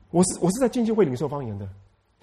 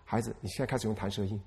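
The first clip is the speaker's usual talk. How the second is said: much softer and lower, gently spoken; hushed voice of the Spirit; 6 semitones lower, 12 dB quieter than usual. Retold or imitated. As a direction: speak it softly like God calling a child. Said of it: "就那天圣灵说". (压低声音温柔地说)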